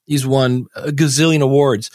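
ordinary talk, nothing marked

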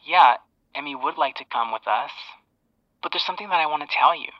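retold confession, quiet and thin down the line; phone call. Boyfriend telling his mom about his girlfriend, quiet on the phone. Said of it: "then I heard him say".

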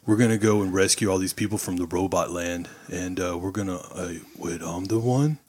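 low, halting dude voice full of uhs; Keanu Reeves. Acted. Podcaster parodying the actor's robot movie role, low halting impression. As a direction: drop deep and stumble blankly through the plot. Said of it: "there's no acting chops whatsoever".

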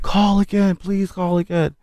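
frantic prisoner pleading for her call; desperate begging. Retold or imitated.

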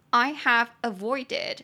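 slow drilled phrase for learners. Shown as a demonstration. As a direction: say "I have avoided" slowly and clearly. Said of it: "phrase-by-phrase teaching".

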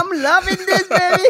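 high, laughing mock delight; gleeful mockery. Girl watching the singer flop, high teasing delight. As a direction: savor his fall with teasing glee. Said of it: "I'm like".

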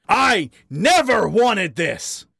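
high, shouted tirade; angry outburst. Acted. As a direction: rage at him until it spills out. Harry's raging diatribe at Wally.

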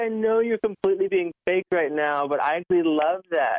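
high, cheerful reply; husband delighted by wife's fake tone, high. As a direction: happily call out the fake. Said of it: "I was like".

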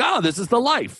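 high, lazy contented sigh; carefree. Retold or imitated. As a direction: savor it like a man on vacation. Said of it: "And I just was like".

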